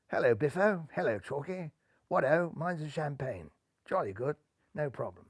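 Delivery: breezy upper-class chap greeting his pals; lightly voiced toff. New skipper greeting his old chums, lightly plummy and quiet.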